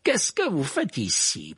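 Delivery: slow, high, drawn-out question; imperious teacher voice; stern interrogation. A narrator's reading. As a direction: question a pupil slowly and suspiciously. Snape confronts Harry.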